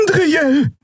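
a friend crying out a warning; calling out. A narrator's reading. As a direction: call out urgently across the room. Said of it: "rief Xea".

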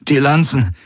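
high, strained gasp; breathless, effortful command. A narrator's reading. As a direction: pant the order out urgently. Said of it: "keuchte Garland".

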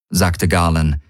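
plain reading voice

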